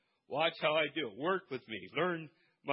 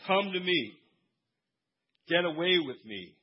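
loud, raised call of Jesus; loud scripture proclamation; preaching at full force; 2 semitones higher, 8 dB louder than usual. Acted. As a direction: proclaim it to the room. Reading Jesus' words forcefully.

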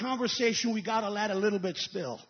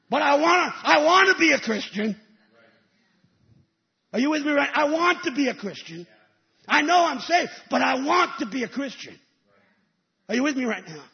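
loud preacher's cry, voice raised; preaching at full force; 3 semitones higher, 8 dB louder than usual. Acted. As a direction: shout your longing out to the church. Preacher shouting his longing.

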